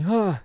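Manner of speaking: lightly imitated sex noise; mock moan. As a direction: do the silly moan sound. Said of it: "the cum sound of me going".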